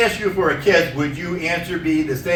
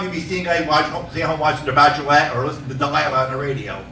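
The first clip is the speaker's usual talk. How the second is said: normal talking voice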